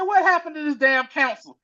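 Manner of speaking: high, indignant outburst; angry. Retold or imitated. Husband angry at the council, high outburst. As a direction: demand it furiously. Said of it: "the dude got mad".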